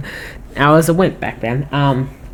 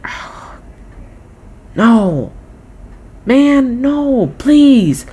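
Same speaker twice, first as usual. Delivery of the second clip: anguished; 8 semitones higher, much slower than usual. Retold or imitated. Sad reaction after Logan.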